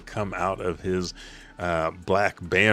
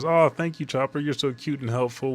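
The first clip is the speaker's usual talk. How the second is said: cutesy thanks; 6 semitones higher than usual. Acted. Gerd hugs Chopper.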